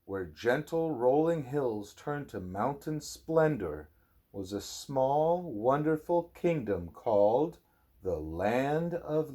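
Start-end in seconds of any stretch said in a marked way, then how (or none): none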